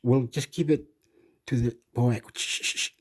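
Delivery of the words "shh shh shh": soft whispered shush; hushing whisper. Real, not acted. Speaker hushing someone near the mic, whispered shush. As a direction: hush someone nearby under your breath.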